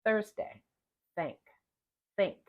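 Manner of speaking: Thursday, thank, think with an airy th; exaggerated th practice. Shown as a demonstration. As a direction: blow air through each th. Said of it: "in the initial position".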